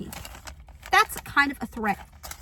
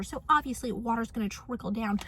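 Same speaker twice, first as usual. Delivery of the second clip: usual speaking voice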